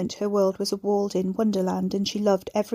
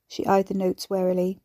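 plain reading voice

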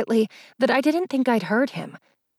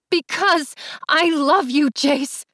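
high-pitched, impassioned outcry; shouted confession; 6 semitones higher, slower than usual. A narrator's reading. Allie confesses love to her stepbrother, shouted. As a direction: cry it out high, as a desperate confession. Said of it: "I shouted so loudly that everyone heard".

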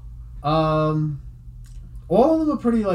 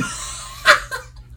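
breathy high-pitched laugh; real giggle at the picture. Real, not acted. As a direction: burst into a squeaky giggle. Laughs at the princess face.

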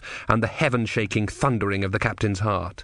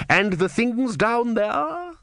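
menacing inquisitor; 12 semitones higher, much slower than usual. Acted. Vorbis probing.

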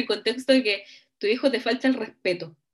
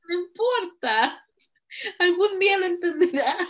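mock tearful; 6 semitones higher than usual. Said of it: "Pero si no entiende".

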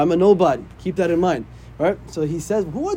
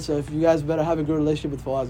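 normal talking voice

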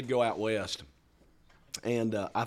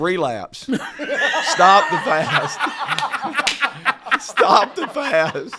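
slow, laughing, high pleading; drawn-out laughing plea, much higher and louder; 9 semitones higher, 7 dB louder, much slower than usual. Retold or imitated. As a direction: beg her to quit the fast through laughter. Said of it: "I was like".

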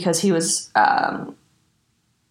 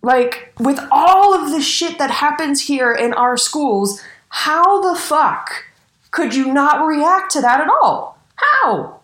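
outraged; 9 semitones higher, 4 dB louder, slower than usual. Real, not acted. Outraged rant about school shooting.

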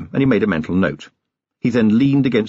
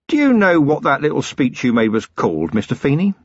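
plain reading voice

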